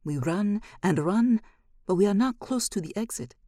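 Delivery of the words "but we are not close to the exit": usual speaking voice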